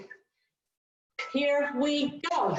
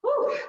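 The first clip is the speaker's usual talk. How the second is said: relieved exhale; 11 semitones higher than usual. Real, not acted. Exhaling after hard interval.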